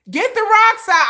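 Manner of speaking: high, loud rallying cry; rally the crowd, half joking; mock call to arms. Creator joking about stoning protest trucks, high loud rallying cry.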